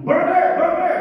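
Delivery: high, loud chanted call; =shout it out for the game. Pretend. Teacher calls out food in class game, high and loud.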